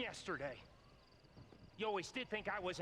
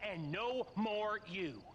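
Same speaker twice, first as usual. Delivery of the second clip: usual speaking voice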